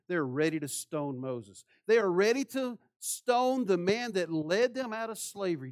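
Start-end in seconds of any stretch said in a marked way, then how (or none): 1.9-5.7 louder, higher preacher's build; preaching at full force. Acted. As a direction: drive it home to the congregation. Preaching climax.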